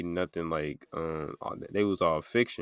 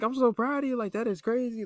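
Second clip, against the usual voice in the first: much higher, gushing teacher voice; excited teacher. Retold or imitated. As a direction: imitate the thrilled teacher squealing. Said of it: "She's like".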